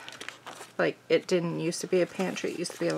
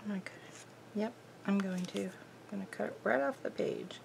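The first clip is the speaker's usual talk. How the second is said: whispered to herself; 10 dB quieter, faster than usual. Real, not acted. Talking to herself while cutting.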